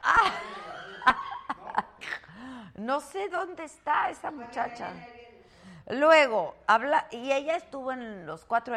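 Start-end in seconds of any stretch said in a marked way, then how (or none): 0.4-2.7 helpless laughing fit. Real, not acted. Host cracking up mid-show, laughing. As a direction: break up laughing.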